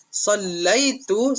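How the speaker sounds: teacher repeats the answer for pupils, slow; slow model phrase. Shown as a demonstration.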